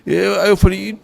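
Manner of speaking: normal talking voice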